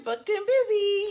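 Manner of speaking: high, sing-out mock boast; Nicole Kidman. Host voicing a carefree actress, high playful crowing. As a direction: crow it high in playful self-satisfaction. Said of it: "she's like".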